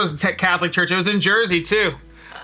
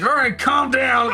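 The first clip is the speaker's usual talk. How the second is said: calm down shout; 8 semitones higher, slower than usual. Retold or imitated. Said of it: "My dad's like".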